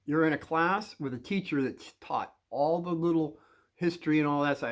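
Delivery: ordinary talk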